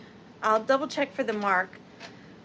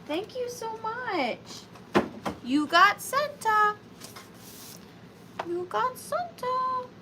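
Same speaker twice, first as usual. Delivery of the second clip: singsong; 9 semitones higher, slower than usual. Real, not acted. High drawn-out singsong thanks.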